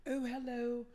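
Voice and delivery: high, polite British mother; British accent. Retold or imitated. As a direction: put on a British woman's lilt. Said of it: "in her British accent".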